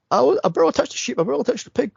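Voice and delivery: quick, high-pitched mock protest; playful defensive pleading; protesting, imitated. Quoting actor's protest about the animals.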